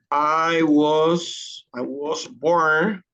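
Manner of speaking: slow, stretched out demo; slow model answer. Shown as a demonstration. Teacher slowly models the answer for students. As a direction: spell it out slowly for the class. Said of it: "you have to say that the".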